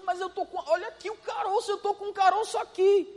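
hammed-up worried patient; complaining sick person. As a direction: whine like someone showing the lump. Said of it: "A pessoa diz".

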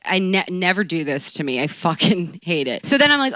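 usual speaking voice

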